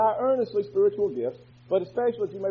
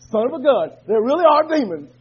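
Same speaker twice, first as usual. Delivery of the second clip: a louder, declaring testimony; 3 semitones higher, 8 dB louder than usual. Real, not acted. Member testifying aloud on request, louder declaration. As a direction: call it out to the whole church. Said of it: "Say it real loud".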